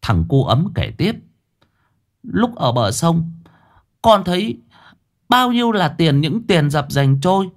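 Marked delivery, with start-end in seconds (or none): 2.2-7.5 put-on high child's voice, eager storytelling; a young boy recounting the river; boy's voice. A narrator's reading. Boy tells his story. (giọng cậu bé cao, kể lại hào hứng)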